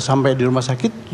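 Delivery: ordinary talk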